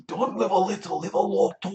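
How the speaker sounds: high, loud Scottish quote of Billy Connolly; Scottish accent. Retold or imitated. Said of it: "I always go Irish when I try to do Scottish".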